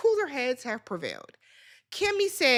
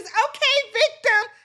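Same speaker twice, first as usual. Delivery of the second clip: mocking taunt; 10 semitones higher, 6 dB louder, slower than usual. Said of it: "Tisha stands up and says".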